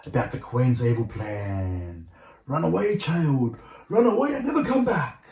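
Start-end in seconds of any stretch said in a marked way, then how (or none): 2.5-5.3 much higher, louder altered warning voice; urgent warning. Acted. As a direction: urge her to flee now.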